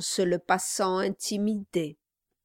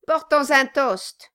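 loud, higher rallying cry; shouted toast; 5 semitones higher, 5 dB louder than usual. A narrator's reading. Host drumming on the table, shouting for a toast. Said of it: "cria-t-il".

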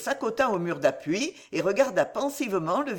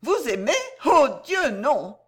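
indignant exclamation, pitched up and peaking on Dieu; mock-horrified denial, higher and louder on the oath; 6 semitones higher, 3 dB louder than usual. A narrator's reading. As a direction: protest with offended astonishment. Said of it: "s'écria-t-elle avec indignation".

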